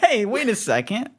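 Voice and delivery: high-pitched mock outrage; indignant heckler. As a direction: pipe up in squeaky disbelief. Heckler at stand-up show.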